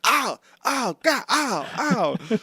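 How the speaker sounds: high-pitched comic yelps of pain; mock pained yelps. Caesar being used as a punching shield, comic high yelps. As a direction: yelp as if hit, hammed up.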